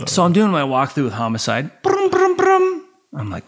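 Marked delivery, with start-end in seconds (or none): 1.8-2.8 high trilled "brum" ringtone noise; imitated phone ring. Phone interrupting the homicide walkthrough, imitated ring. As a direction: mimic a ringing phone high in the voice.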